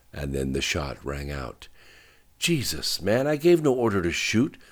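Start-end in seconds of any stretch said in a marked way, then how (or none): 2.4-4.6 alarmed, higher-pitched captain's shout; urgent shouted command. A narrator's reading. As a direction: shout an angry order, voice raised. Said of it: "shouted the captain".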